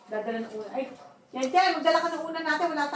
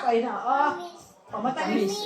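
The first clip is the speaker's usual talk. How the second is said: normal talking voice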